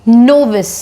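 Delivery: "novice" said as NOV-iss, stress first; teacher presents "novice" to the class; pronunciation demo. Shown as a demonstration. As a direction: model "novice" clearly with a falling close. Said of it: "इसको कैसे pronounce करेंगे?".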